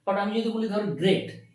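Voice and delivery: usual speaking voice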